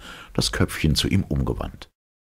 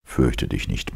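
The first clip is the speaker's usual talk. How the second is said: lower, reassuring hero voice; calm man's voice; 6 semitones lower than usual. Acted. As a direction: reassure her gently. Lossi voiced by narrator. (ruhige, tiefere Männerstimme)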